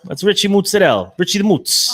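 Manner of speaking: playful, exaggerated Jersey accent; thick Jersey accent. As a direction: announce the nickname in broad Jersey. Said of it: "accentuating our Jersey accents".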